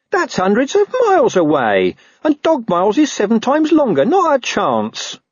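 high-pitched, indignant dog voice; protesting talking dog. Acted. Dog refusing a long journey, high indignant protest.

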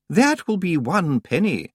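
a lightly voiced prim young librarian; Miss Jenkins. A narrator's reading. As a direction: answer crisply and politely.

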